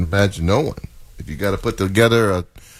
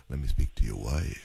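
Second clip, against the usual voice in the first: mock seductive; 10 semitones lower, 10 dB quieter than usual. Cohost mocks Fred asking for caller's wife, low sultry murmur. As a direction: lower and much softer, sultry. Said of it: "You said it in a different voice".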